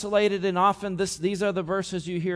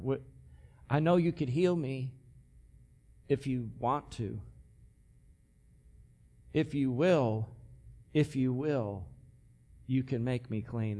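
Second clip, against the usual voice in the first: softer, lower, hushed pleading; pitiful plea; 5 semitones lower, 6 dB quieter than usual. Acted. Humble leper asking Jesus for healing, soft pitiful plea. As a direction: ask timidly, unsure of the answer. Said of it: "a pathetic, poor, pitiful leper".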